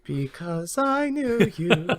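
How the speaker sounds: high, slow, hammed-up sentimental line; mock dramatic farewell. Cohost teasing his friend with a mock weepy send-off, slow and high. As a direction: play it as a fake tearful goodbye.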